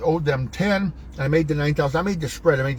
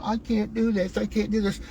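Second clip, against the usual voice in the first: higher, quieter whiny voice; mock whiner; 6 semitones higher, 4 dB quieter than usual. Coach mimicking whiners who give up, mock whine. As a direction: whine it as a mocking imitation. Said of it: "one of them whiners going".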